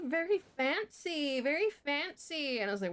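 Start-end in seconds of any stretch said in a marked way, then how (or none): 0.1-1.4 very fancy drawled slow and high; slow mock posh. Teachers mocking her family's money in a posh drawl. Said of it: "they were like". 1.4-2.6 slow, high mock-posh drawl on very fancy. Teachers mocking her rich family with a slow posh voice. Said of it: "they were like".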